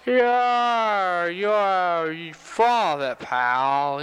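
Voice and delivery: put-on slurred-out, dragging drunk heckle; tough barroom drunks. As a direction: drag the words like a tough drunk. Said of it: "the drunks around the bar, pretty tough area".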